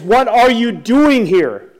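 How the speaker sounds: slow, loud imitated yell; threatening demand. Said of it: "he's got the gun pointed at him".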